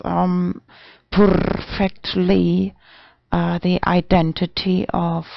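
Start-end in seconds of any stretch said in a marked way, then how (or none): 1.1-2.7 "perfectly" with a long rolled, purring R; purred R. Acted. Streamer on her kitty identity, purring the R in "perfectly". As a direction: stretch "perfectly" slowly, trilling the R like a cat.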